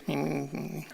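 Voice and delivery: soft mock jabbering; quiet squeaky gibberish; squeaky background chatter. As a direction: mimic faint chatter behind the speaker. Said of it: "I can hear Armando in back".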